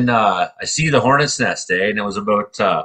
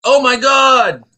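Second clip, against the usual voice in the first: much higher, louder frightened cry; panicked son; 13 semitones higher, 4 dB louder, much slower than usual. Retold or imitated. Son seeing his dad on the ground, high panicked cry. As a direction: scream for his dad in alarm. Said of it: "he's like".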